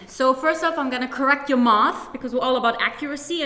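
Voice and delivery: normal talking voice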